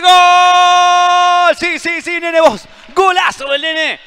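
huge high goal shout; goal call. Real, not acted. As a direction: scream the goal out at full power. Live goal call by commentator.

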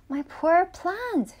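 slow, high, dismayed wail; crying out. A narrator's reading. Tra finds her plant dying, crying out. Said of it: "she cried".

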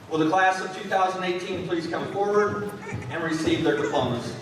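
ordinary talk

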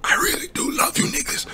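soft whispered aside. Real, not acted. Streamer assuring sponsors of his love, whispered. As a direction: breathe it out as a secret.

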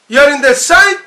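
loud order with a rising end; drill command. Retold or imitated. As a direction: call it out like a commander.

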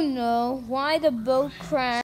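usual speaking voice

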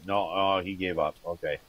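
normal talking voice

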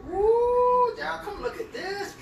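high squeal, calling everyone over; excited call. Real, not acted. Exclaims, calls others over.

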